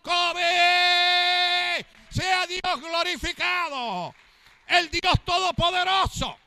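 full-force pulpit shout of triumph; fiery preaching. Acted. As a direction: cry it out over the congregation, slowing to a long shout.

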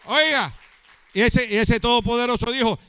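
full-force pulpit proclamation; fiery preaching. Acted. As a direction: proclaim it at the top of the voice.

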